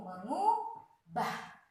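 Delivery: "mengubah" drawn out very slowly, me-ngu-bah; slow syllable reading. Shown as a demonstration. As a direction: spell out "mengubah" slowly for pupils. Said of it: "Jadi bacanya harus ngu". (ucapkan "mengubah" sangat pelan, per suku kata)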